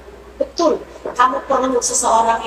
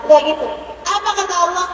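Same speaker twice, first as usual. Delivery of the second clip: ordinary delivery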